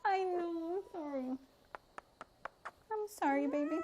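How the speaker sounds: lightly cooing sorry; soothing pet talk. Real, not acted. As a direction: comfort the upset cat. Soothing the cat.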